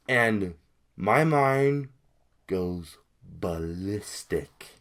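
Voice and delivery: drawn out, word by word; slow dramatic emphasis. Real, not acted. Vlogger telling viewers of his outrage, slow and deliberate. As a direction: stretch BALLISTIC out slowly for effect.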